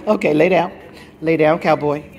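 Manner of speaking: loud, firm command to lie down; firm dog command. Real, not acted. Dog owner firmly ordering her dog Cowboy down. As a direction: tell the dog to settle, with authority.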